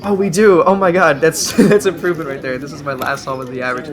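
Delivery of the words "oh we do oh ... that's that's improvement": delighted, louder and higher burst; real happy surprise. Real, not acted. Vlogger learns about extra inspection time, surprised. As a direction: caught off guard by good news, spills out.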